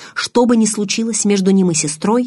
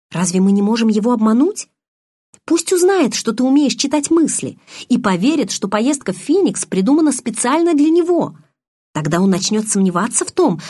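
высоко, с жаром; выпали план с азартом; увлечённо; 6 semitones higher, 3 dB louder than usual. A narrator's reading. Белла взволнованно излагает план. (Белла увлечённо предлагает план Эдварду)

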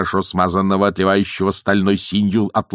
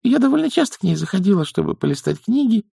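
high, fast little voice; confessing young witch; 13 semitones higher, faster than usual. A narrator's reading. Young witch admits her visits to elders, hurried confession. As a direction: confess it quickly, high-pitched. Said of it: "призналась Маград".

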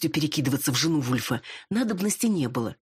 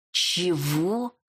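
sulky what; 2 semitones higher than usual. Acted. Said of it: "насупилась я".

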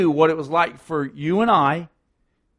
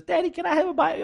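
put-on higher, fast little girl voice; a preacher voicing a small girl who asks her daddy; 13 semitones higher, faster than usual. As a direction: pipe it up like a small daughter.

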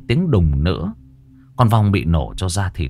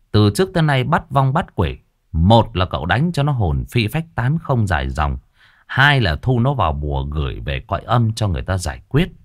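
ordinary delivery, nothing marked

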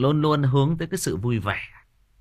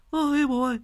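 high mock wail that fades back toward a normal voice; mock crying kids; 12 semitones higher than usual. The grandkids wail to their dying grandfather, hammed-up crying. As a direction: play the sobbing grandkids, hammed-up. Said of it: "nó mếu máo, nó khóc".

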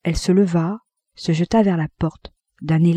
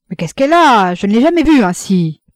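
loud, high-pitched worried question; distressed, emphatic dismay; alarmed bewilderment; 4 semitones higher, 7 dB louder than usual. A narrator's reading. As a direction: appeal to your son in alarm. Bewildered husband turns to his son.

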